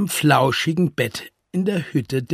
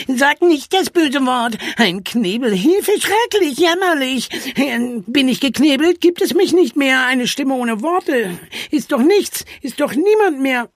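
high, hammed-up lament with stretched words; wailing; 11 semitones higher than usual. A narrator's reading. Charlie fears silence means nonexistence, wailing. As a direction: lament pitifully, pitch way up. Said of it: "jammerte Charlie".

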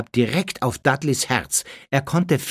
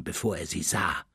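plain reading voice

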